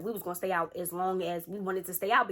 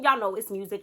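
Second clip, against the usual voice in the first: ordinary talk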